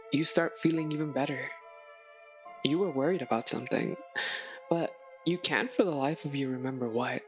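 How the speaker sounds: plain reading voice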